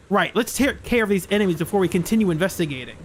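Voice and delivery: ordinary talk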